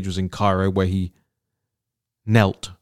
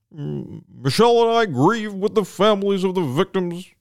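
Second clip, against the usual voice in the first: much higher, measured presidential voice; Obama impression; 10 semitones higher than usual. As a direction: put on Obama's solemn cadence. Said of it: "Should I do it in my Obama impression voice?".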